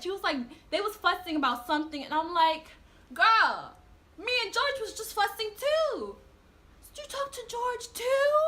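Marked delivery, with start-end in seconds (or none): 3.2-8.3 shocked gossip. Retold or imitated. Shocked girls comparing notes.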